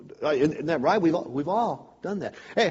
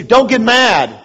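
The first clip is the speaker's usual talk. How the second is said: louder, higher mock scolding; mock wife's scold; 6 semitones higher, 10 dB louder, slower than usual. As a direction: imitate the wife snapping back, lightly. Wife's retort imagined.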